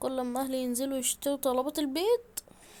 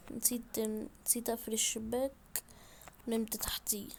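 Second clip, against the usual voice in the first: whispered aside; 2 semitones lower, 9 dB quieter than usual. Real, not acted. Aside after confessing silly mistake.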